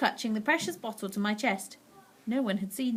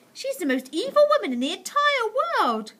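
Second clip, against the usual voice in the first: higher, louder, grave; grandmother voice; 12 semitones higher, 5 dB louder than usual. A narrator's reading. Grandmother condemning the witch. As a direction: say it with disgust.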